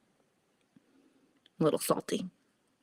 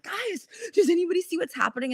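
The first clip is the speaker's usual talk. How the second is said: frantic excited call; 11 semitones higher than usual. Retold or imitated. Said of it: "I'm like".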